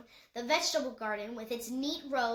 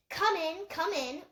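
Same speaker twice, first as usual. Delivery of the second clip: higher, eager welcome; encouraging; 5 semitones higher than usual. Acted. Vola calling the grandfather in from the kitchen, eager and welcoming. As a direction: welcome him in warmly, lifting up. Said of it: "Vola encouraged".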